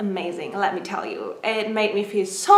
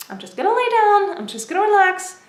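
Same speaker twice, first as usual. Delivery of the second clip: retold self-talk; 10 semitones higher, 3 dB louder than usual. Retold resolve while ill with cold.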